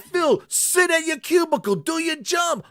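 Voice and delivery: yelling at a lazy worker; angry boss shout. Retold or imitated. Boss yells at Phil.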